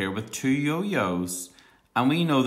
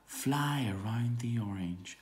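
soft repeat; 11 dB quieter than usual. Shown as a demonstration. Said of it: "Can you say that?".

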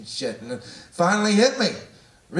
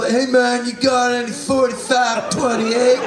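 higher, louder mock customer voice; 7 semitones higher, 4 dB louder than usual. Customer asking the Gap clerk for jeans, loud and high. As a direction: ham up a customer calling to the clerk.

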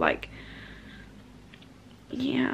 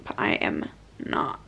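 whispered under breath; 7 dB quieter than usual. Shown as a demonstration. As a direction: whisper it to yourself. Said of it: "repeated, second whispered".

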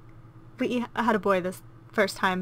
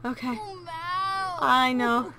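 soothing coo; 5 semitones higher than usual. Pretend. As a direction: hush a hurting child tenderly. Comforting crying baby character.

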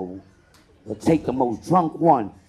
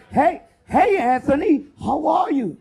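excited greeting; 9 semitones higher than usual. Retold or imitated. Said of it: "I had one person say".

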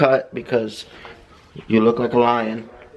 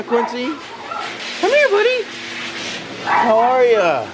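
pet talk; 8 semitones higher than usual. Real, not acted. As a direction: coo and fuss over the dog. Talking to dog at groomer.

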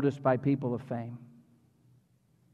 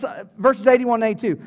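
ordinary talk

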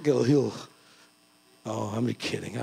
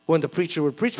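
ordinary talk, nothing marked

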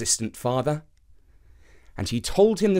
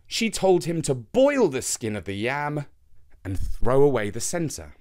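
usual speaking voice

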